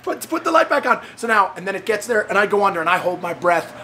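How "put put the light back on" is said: high, frantic repeated cries; retold panic, starting in a shout and easing off; panicked plea. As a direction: stammer out a panicky demand. Retold panic in dark water.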